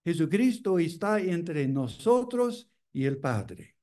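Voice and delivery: usual speaking voice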